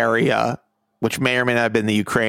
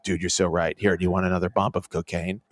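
mock bro, lower and quieter; laid-back dude offering more; a light stoned Scott Disick; 7 semitones lower, 5 dB quieter than usual. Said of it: "he's like".

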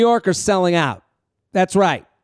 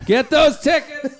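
high announcer call; 7 semitones higher than usual. Pretend.

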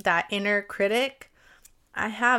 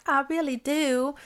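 imitating the friends' foreign accent, higher; playful higher mock accent on I really do; 6 semitones higher than usual. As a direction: tease her by copying the friends' accent. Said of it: "with their accent".

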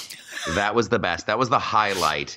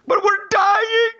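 King Julian wail; slower than usual. Retold or imitated. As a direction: wail it like a squealing lemur. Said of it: "I don't know why I'm talking like this".

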